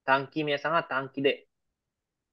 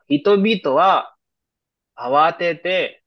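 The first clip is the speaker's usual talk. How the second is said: slow example read; 5 dB louder, slower than usual. Shown as a demonstration.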